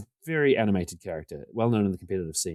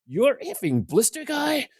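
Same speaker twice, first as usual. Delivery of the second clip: much higher, slow incredulous squawk; a rival realising who he faces, mock disbelief; astonished; slower than usual. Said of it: "And Gary Wise goes".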